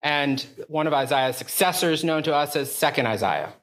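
ordinary talk, nothing marked

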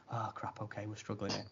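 dismayed mutter. Retold or imitated. Dismayed aside, very quiet.

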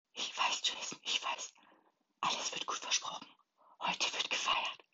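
soft, voiceless whisper into the ear; whispered comfort. A narrator's reading. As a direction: whisper reassurance to a grieving brother. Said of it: "flüsterte sie mir ins Ohr". (tröstendes Flüstern ins Ohr)

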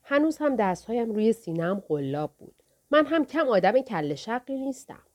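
plain reading voice

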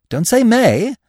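high, bouncy correction; breezy, buoyant young man. A narrator's reading. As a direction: pitch it up with cheery confidence. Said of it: "said Freddy buoyantly".

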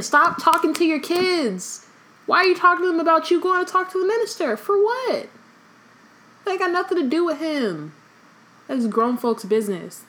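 exasperated. Real, not acted. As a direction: scold the parent, fed up. Exasperated rant at a parent.

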